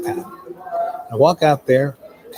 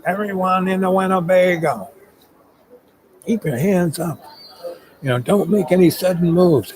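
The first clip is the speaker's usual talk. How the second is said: high, sustained official call-out; police order; 7 semitones higher than usual. Retold or imitated. Police at roadblock to everyone in Winnebago, commanding call.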